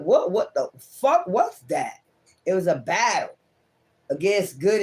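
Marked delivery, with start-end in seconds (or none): 0.0-2.0 slow stunned mock disbelief. Voicing stunned querent.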